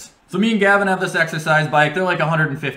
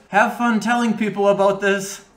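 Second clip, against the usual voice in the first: higher, teasing mock wife voice; the wife teasing the delivery guy as he leaves; smug parting jab; 6 semitones higher than usual. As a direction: toss it out as a sly farewell.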